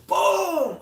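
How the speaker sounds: loud, high, drawn-out sound effect; big stretched-out impact shout; explosive boom. Retold or imitated. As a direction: blast it out like a launch. Imitates the ball launch.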